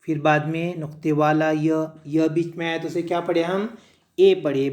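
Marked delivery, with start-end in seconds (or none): none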